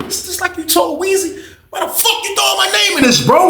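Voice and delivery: very high, mock-outraged squeal; alarmed protest. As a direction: protest being dragged in, voice way up high. Acted protest at being named.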